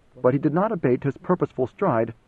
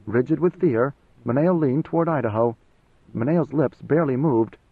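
plain reading voice

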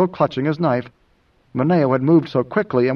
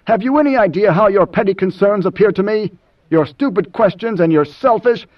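heated, higher-pitched tirade; snapping; 6 semitones higher, 4 dB louder than usual. Acted. Moneo rails at Idaho's petty concerns, snapping. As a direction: scold him angrily until words break off. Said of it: "Mineo snapped".

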